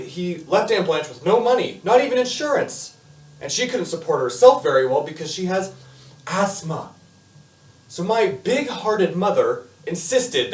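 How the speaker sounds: lively storytelling with punched words and dramatic pauses; Eugene. Acted. Eugene explains Aunt Blanche's situation to the audience, animated confiding.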